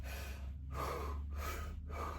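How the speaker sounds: slow, heavy exhales; deep breaths. Shown as a demonstration. Showing how he calmed his panic, deep hard breaths. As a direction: breathe out hard, as if steadying yourself. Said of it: "multiple deep breaths".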